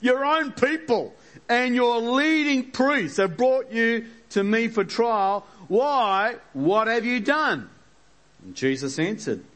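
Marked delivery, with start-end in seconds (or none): 0.0-7.7 high, slow, accusing Pilate. Acted. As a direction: press him like a suspicious governor. Pilate voiced in the reading.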